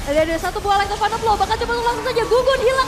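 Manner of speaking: loud, high excited call; esports caster. Real, not acted. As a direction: build the hype on the play. Caster calls the shutdown.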